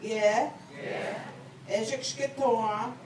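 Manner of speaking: phrase by phrase, long pauses for repetition; said slowly. Shown as a demonstration. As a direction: lead a group recitation, lifting each phrase's end. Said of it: "I'm gonna say it slowly".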